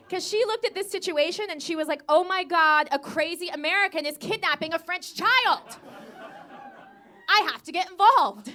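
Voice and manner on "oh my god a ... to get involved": loud, raised-pitch alarmed bystander; mock alarm. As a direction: act out a woman rushing to intervene. Alarmed Parisian woman sees a kidnapping.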